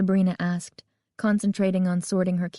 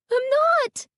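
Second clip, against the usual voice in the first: very high panicked protest; frantic high-pitched denial; shrill with panic; 4 dB louder than usual. A narrator's reading. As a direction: insist in a piercing, panicky squeal. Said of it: "her voice shrill with panic".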